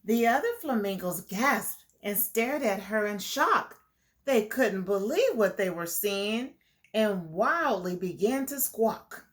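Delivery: put-on reading a picture book to kids; lilting storybook read; story narration. A narrator's reading. Teacher reading the flamingo story to the class, lilting. Reads rhyming story aloud.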